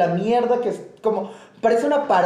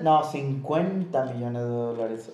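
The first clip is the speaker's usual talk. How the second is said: deadpan; 6 semitones lower, 5 dB quieter, slower than usual. Retold or imitated. Nolan calmly correcting the sum.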